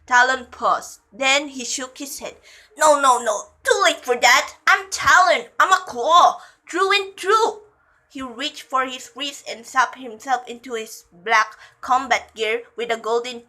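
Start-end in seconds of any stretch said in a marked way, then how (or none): 2.8-7.6 loud, raised-pitch declaration; defiant outburst. A narrator's reading. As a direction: declare it loudly, refusing all comfort. Talon defiantly rejects comfort.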